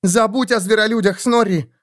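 high, curt woman's voice; sharp retort. A narrator's reading. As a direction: cut him off sharply. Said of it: "отрезала Кэт".

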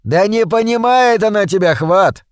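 amused, condescending call. A narrator's reading. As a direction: talk down with a smirk. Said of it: "со снисходительной улыбкой".

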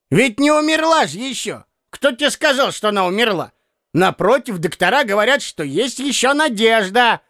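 put-on a fussy old clerk scolding a younger man; hint of a grumbling old man. A narrator's reading. Piskarev insisting she lives.

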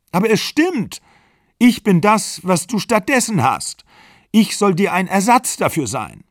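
high, loud, upset comeback; acted outburst in a breakup row; heated insistence. A narrator's reading. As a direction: insist at the top of your register.

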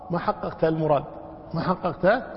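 normal talking voice

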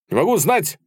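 high, crisp military reply; loud, distinct shout. A narrator's reading. Viktor answering his commander, loud and distinct. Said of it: "громко и отчётливо прокричал".

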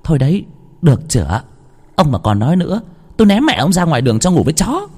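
high, fast scolding threat; irritated wife threatening to throw husband out; angry threat. Acted. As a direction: threaten him, exasperated.

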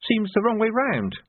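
a lightly voiced young soldier, puzzled; young recruit voice. Young recruit in narrated dialogue.

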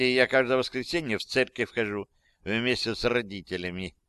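usual speaking voice

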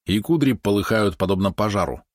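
ordinary delivery, nothing marked

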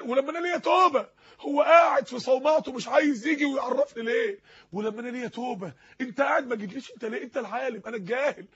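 impassioned reproach, acted as a crumbling sinner; man breaking down. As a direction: plead and accuse, swelling on the questions. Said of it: "انا بتخيل الراجل ساعتها وهو بينهار". (تائب منهار يعاتب بحرقة)

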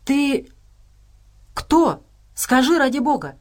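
high-pitched, halting amazement; astonished. A narrator's reading. Dima questions the unexpected woman, astonished. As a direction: break up the question in sheer astonishment. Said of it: "изумлённо спросил Дима".

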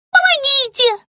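a very high, piercing cry for help; terrified squeak. Acted. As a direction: scream as the snake grabs you. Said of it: "пискнула пленница".

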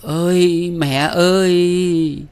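high, loud drawn-out wail; keen it out in grief, slowly; wailing cry. A narrator's reading. Bride wails for her dead mother, high and loud. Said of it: "nàng tru lên những tiếng não nùng lanh lảnh".

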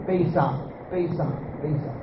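dwindling mocking repeat of paisa; fading repetition. Real, not acted. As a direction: let the word fade away as you repeat it. Mocking worldly chase.

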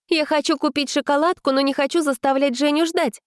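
put-on high, bright girlish voice; a young girl making an excuse. A narrator's reading. Vika's quoted excuse.

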